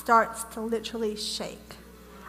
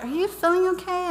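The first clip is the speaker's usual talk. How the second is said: higher-pitched motherly check-in; concerned mom; 7 semitones higher than usual. Retold or imitated. Retelling mom checking on her that night, concerned. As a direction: lift the voice into a worried question.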